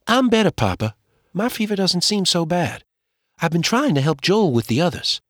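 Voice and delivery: a brave little girl playing down her fever; young girl's voice.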